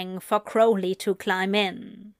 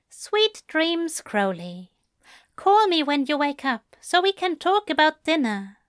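higher, fond goodnight; tender Aziraphale voice; 6 semitones higher than usual. Acted. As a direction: say goodnight like a doting angel.

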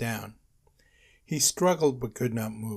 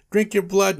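loud, high vampire threat; yelling; 9 semitones higher, 8 dB louder than usual. A narrator's reading. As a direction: yell the threat at a raised pitch. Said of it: "Nosferatu yelled".

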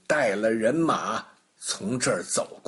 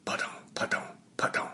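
whispered plop sounds, much softer; soft plopping; 10 dB quieter, much faster than usual. Acted. Narrator imitates coins plopping into the basin, soft whispered plops. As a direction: coins dropping into a basin one by one.

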